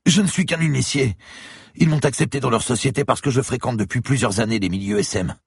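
a beaten lawyer gasping in fear; frightened bleeding man pleading with the cop; terrified captive. Acted. Lawyer confesses.

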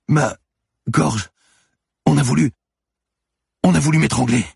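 high, loud broken phrases; alarmed, building to a cry; panicked realisation. A narrator's reading. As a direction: cry out as the attack comes back to you. Manon realises she was strangled.

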